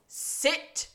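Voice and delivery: short, high mock command; mock yell. Podcaster retelling the judge silencing the crowd, mild mock yell. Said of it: "Nigel glares around and just yells".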